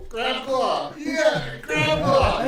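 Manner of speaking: loud, high raucous jeer; rowdy shouting. Acted. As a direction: holler it like a rowdy bro. Rowdy brothers jeering with glasses.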